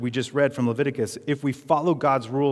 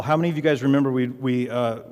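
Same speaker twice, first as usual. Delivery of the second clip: ordinary delivery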